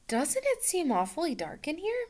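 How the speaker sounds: high, coaxing voice; sweet princess. Acted. Princess luring the drowsy magician, high and coaxing. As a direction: coax him gently toward sleep. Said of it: "said Buddir".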